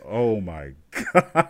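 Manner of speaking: drawn-out exclamation through laughter; slow, laughed-out mock horror; laughing disbelief. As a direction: crack up while retelling the shock. Laughing while retelling his reaction.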